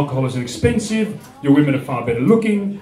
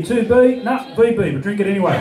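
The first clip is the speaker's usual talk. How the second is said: put-on Aussie accent; 3 semitones higher than usual. Aussie Shakespeare talking beer, broad Australian accent. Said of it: "have an Aussie Shakespeare".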